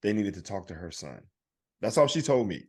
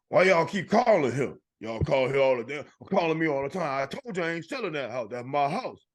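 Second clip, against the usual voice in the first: put-on higher, indignant old woman; fed-up old lady voice; 4 semitones higher than usual. Comedian voices an old lady scolding scam callers. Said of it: "And I go".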